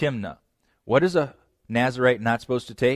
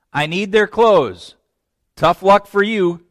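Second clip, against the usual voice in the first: callous Samson voice; 6 semitones higher, 8 dB louder than usual. Retold or imitated. Samson voiced taking dead men's clothes.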